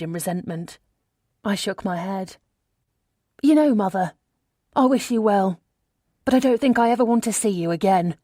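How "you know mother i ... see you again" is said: firm farewell. A narrator's reading. Cutting ties with mother.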